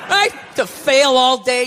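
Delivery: ordinary talk, nothing marked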